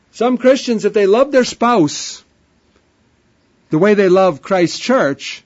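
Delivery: building sermon at full force; fervent preaching. Acted.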